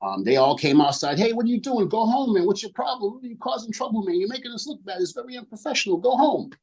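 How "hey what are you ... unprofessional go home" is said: much higher put-on voice, scolding; mock scolding bandmates. Band members scolding their drummer to go home, high mock voice. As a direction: pitch way up to play the band telling him off.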